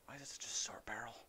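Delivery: puzzled whisper. Real, not acted. Gamer puzzling over a short-barrelled rifle, whisper. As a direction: breathe the question to himself. Inspecting gun quietly.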